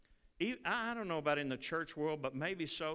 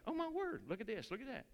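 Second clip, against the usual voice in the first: mock amazement; 7 semitones higher, 5 dB quieter, faster than usual. Amazed beachcomber finding shells.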